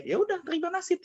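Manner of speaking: very high, sing-song mock voice; mocking laughter-tinged dismissal. Laughing mother telling her son to accept his fate, sing-song. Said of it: "kata dia".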